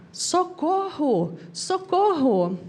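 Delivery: drawn-out mock yell; mock cry for help. Passengers in a shaking plane calling for help, half-voiced mock shout. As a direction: stretch it out like a panicked passenger, not full volume. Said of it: "o pessoal começou a gritar".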